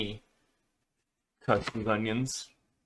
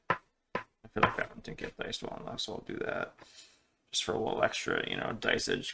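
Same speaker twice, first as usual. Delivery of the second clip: whispered muttering; 7 semitones lower, 8 dB quieter than usual. Real, not acted. Muttering while chopping.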